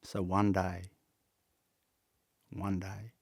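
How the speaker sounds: soft and low, with long pauses; real emotion, halting and hushed; choked with emotion. Real, not acted. Man moved by recalling his youthful faith in hardship, emotional and quiet. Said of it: "Sorry, I'm getting emotional".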